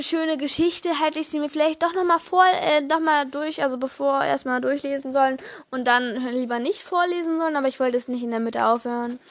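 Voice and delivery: ordinary talk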